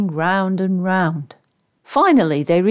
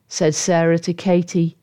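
ordinary delivery, nothing marked

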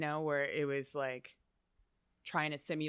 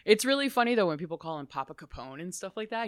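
normal talking voice

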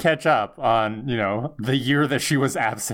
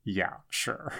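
starstruck brush-off; 4 semitones lower, 10 dB quieter than usual. Retold or imitated. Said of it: "she's slightly starstruck".

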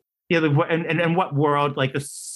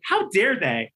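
higher-pitched mock outrage; indignant; 8 semitones higher, slower than usual. Applicant reacting to a supposed rejection call, indignant. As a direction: play up the affronted pride. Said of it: "I was so dumb".

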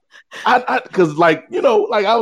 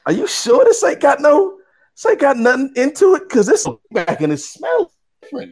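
high-pitched, puzzled voice retelling doubt; suspicious questioning; 9 semitones higher than usual. Retold or imitated. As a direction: question it warily, pitched well up. Acted suspicion about the pipe.

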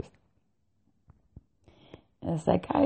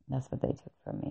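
whispered aside; 4 semitones lower, 11 dB quieter, faster than usual. Real, not acted. Quiet aside after correcting himself.

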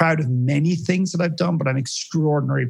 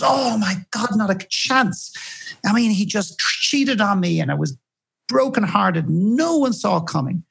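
dramatic heartbroken woman; 7 semitones higher than usual. Retold or imitated. Coach imitating the jilted participant, high hammed-up protest. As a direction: play up her wronged outrage.

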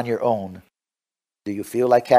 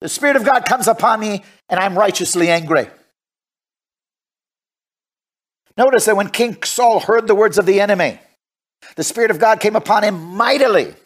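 raised, emphatic preacher's delivery; forceful preaching; 9 semitones higher, 5 dB louder than usual. Real, not acted. Preacher tells how the Spirit came upon him, forceful preaching. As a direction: proclaim it to the congregation.

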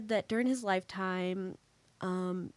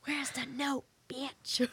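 high-pitched but not shouted; playful high-voiced demand at normal volume; mock demand, held back; 6 semitones higher, slower than usual. Joking demand to a ghost at seance, held-back mock demand. Same demand repeated, much softer.

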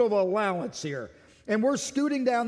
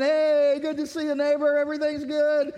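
high, hearty mock friendliness; cheery neighborly greeting; 6 semitones higher, 4 dB louder than usual. Preacher acting a friendly driver in traffic, cheery. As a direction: call out brightly to a neighbor. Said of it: "you just waving, smiling".

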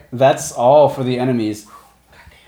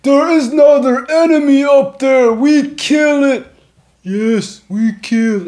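monster voice; 13 semitones higher, 6 dB louder than usual. Acted.

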